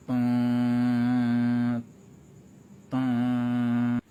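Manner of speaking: low mock ship horn honk; ship horn imitation. Shown as a demonstration. Judge imitates a ship horn to mock a voice, low honk. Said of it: "Yang gini tuh".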